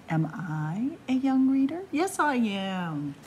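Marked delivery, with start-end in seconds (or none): none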